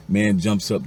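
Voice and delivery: ordinary delivery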